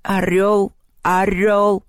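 bird chant with drawn-out cheer; yelling bird fans. A narrator's reading. Birds rooting for their champion, yelled chant. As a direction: chant it like a flock of supporters. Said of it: "заорали птицы".

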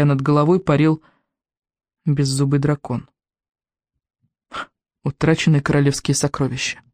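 ordinary delivery, nothing marked

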